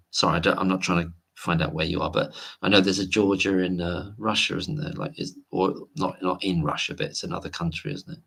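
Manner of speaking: normal talking voice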